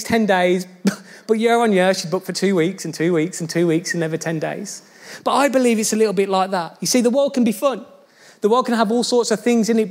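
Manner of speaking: ordinary talk, nothing marked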